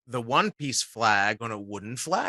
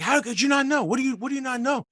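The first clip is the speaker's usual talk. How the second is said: high-pitched demanding yell, fast at the end; mock the fan who can't believe it; retold yelling; 10 semitones higher, faster than usual. Fan yelling at a tired voice actor, high and demanding. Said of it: "he starts yelling at me".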